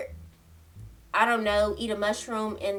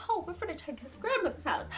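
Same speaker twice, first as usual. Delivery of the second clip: soft, higher mock-innocent echo; 6 semitones higher, 10 dB quieter than usual. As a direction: parrot the plan back, high and hushed. Mockingly echoing friend's plan.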